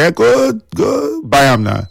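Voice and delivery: slow, high, teasing "go buy am now"; teasing brush-off. Retold or imitated. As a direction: send her off with mock scorn. Mock-quotes own dismissive reply.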